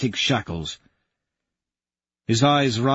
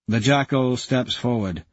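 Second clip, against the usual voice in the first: ordinary delivery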